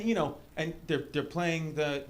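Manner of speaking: ordinary talk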